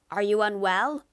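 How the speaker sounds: loud, concerned call upward. Acted. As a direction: shout the question up to the window. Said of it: "He called to the laboratory window".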